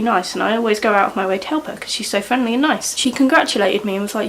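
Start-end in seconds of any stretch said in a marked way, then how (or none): none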